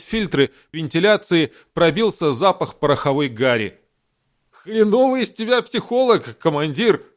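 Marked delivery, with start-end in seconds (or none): 4.7-7.0 high, heated outburst at the commander; exasperated doctor. Acted. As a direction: snap it in frustration. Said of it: "в сердцах бросил врач".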